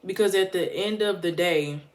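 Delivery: ordinary talk, nothing marked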